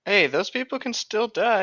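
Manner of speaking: normal talking voice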